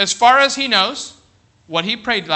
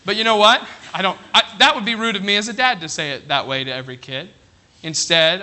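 usual speaking voice

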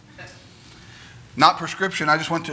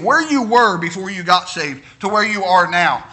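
ordinary talk

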